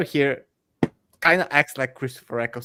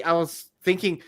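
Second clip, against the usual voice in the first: ordinary talk, nothing marked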